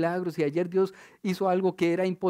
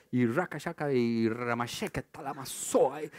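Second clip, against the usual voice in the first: a light, slowed mock of tongues prayer; imitating speaking in tongues; 5 semitones lower, 5 dB quieter than usual. Preacher imitating his grandfather praying in tongues, a light mock. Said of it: "mi tata oraba en lenguas mucho".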